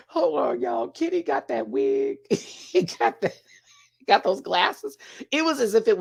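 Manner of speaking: high-pitched, talking through laughter; squeaky with real laughter; cracking up. Real, not acted. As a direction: struggle to get the words out while laughing. Cracking up over the wig.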